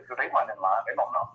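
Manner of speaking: ordinary talk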